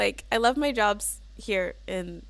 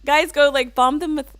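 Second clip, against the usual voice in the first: hard, pushy push on go and bomb; aggressive; 3 semitones higher, 8 dB louder than usual. Real, not acted. Streamer urging chat to raid, sounding aggressive. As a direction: give an accidental aggressive command. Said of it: "sounded, like, really aggressive".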